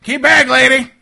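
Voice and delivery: loud, high, slowed order to stay away; warning shout. Acted. Game master as shotgun guards warning the woman, warning shout. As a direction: shout to hold someone off. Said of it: "they're like".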